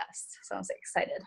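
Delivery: softly whispered excitement. Real, not acted. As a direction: breathe it out in a whisper. Closing share, kids asleep.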